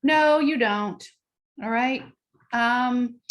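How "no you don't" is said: dismissive parent. Retold or imitated. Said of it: "Other ways are".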